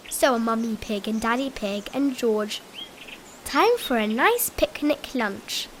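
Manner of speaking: plain reading voice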